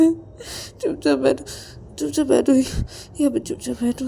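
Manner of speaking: high-pitched worried begging; distressed pleading. Acted. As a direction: plead with an injured friend to stop. Ravi pleads to stop bleeding Rahul. (घबराकर ऊँची आवाज़ में मिन्नत करना)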